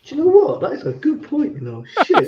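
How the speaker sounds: high, overdone mock surprise; hammy acting. Retakes his staged answer to the host, hammed up high. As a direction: ham it up like a bad soap actor. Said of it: "ham and chips acting now".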